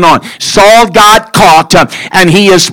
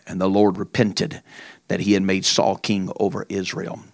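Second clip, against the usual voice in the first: quiet preaching; 10 semitones lower, 9 dB quieter than usual. Acted. Preacher closing the sermon to the congregation, hushed. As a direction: draw them in, hushed and solemn. Soft slow preaching close.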